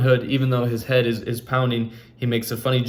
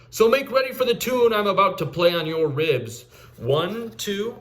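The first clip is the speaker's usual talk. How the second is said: higher, jaunty outlaw's challenge; bold challenge; 9 semitones higher than usual. Acted. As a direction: taunt him like a cocky swordsman.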